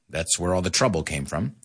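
normal talking voice

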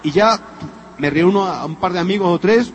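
ordinary talk

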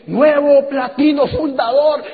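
high, loud, drawn-out proclamation; announcer calling an award. Retold or imitated. Seminar presenter recognizing the new qualifier, ceremonial announcement. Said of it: "al que me dijo así".

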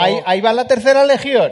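loud, high-pitched Aragonese exclamation; Aragonese accent. Acted. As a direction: call it out in a hammed-up Calatayud accent. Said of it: "teníamos un cierto acento".

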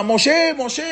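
very high-pitched call of a name; retold yelling. Paró searching the streets for Moshe, imitated high yelling. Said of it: "Y él gritaba".

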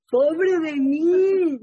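slow, loud mock wail of self-pity. To the group, mocking a mother's self-pity, slow and wailing. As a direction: drag it out like a whining martyr.

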